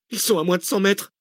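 high, panicky warning; alarmed. A narrator's reading. Passenger warning the driver of closing pursuers, alarmed. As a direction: warn them urgently, pitch shooting up.

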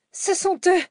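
very high-pitched cry of alarm; panicked yell. A narrator's reading. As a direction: cry out that the pursuers are here. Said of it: "hurla sans briser les cordes vocales". (cri d'alarme suraigu)

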